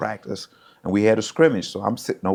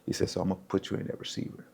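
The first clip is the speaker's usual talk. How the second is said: hushed retold coach; 9 dB quieter, faster than usual. Coordinator explaining the plan to a freshman, hushed. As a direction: quietly let the player in on it. Coordinator confiding to player on sideline.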